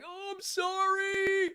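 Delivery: high, slow whimpering apology; mock the crying performer; whiny apology. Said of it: "And they were like".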